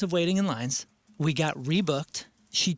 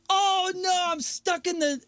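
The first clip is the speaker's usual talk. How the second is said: high, hammed-up German-accented yelling; 14 semitones higher, slower than usual. German guy stuck at the airport, ranting in a German accent. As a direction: imitate a distraught German losing it. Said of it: "in this German accent".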